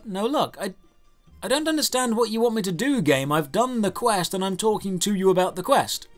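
ordinary delivery

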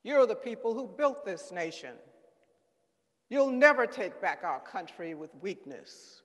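loud, forceful address to a mob; emphatic rally oratory; rallying speech. A narrator's reading. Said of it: "a mob stands listening to Trump".

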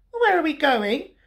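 high, small cub voice; Little Bear. A narrator's reading. Little Bear asks where Big Bear is going, high cub voice. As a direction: ask high like an uncertain cub.

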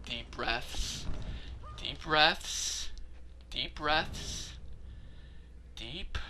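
soft, low, measured self-soothing; calming oneself. Real, not acted. Player calming down with deep breaths, hushed and low. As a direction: murmur it between pauses to settle down.

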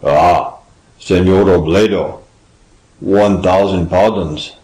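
low, timorous character voice; timorous gasp. A narrator's reading. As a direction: beg pardon as a cowed old man. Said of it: "he gasped timorously".

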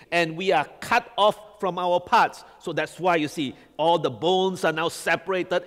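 usual speaking voice

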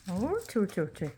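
high, loud praise for a pet; talking to a dog. Real, not acted.

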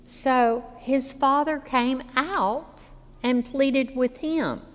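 usual speaking voice

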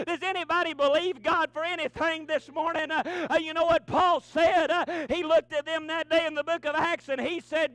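loud, high preacher cadence with gasping uh's; preaching at full force. Acted. Pastor stirring the church about faith, preached hard. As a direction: drive each line home to the congregation.

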